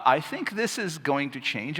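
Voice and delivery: ordinary talk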